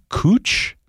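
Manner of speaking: COOCH with oo and an English ch; guessed Welsh pronunciation. Shown as a demonstration. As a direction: try COOCH as a tentative reading. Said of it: "which I guess would be".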